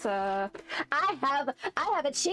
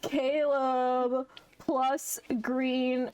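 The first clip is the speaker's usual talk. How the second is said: drawn out slowly, word by word; savouring each item in the list; slow tally; much slower than usual. Real, not acted. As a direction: spell it out slowly like a sum. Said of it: "slow list".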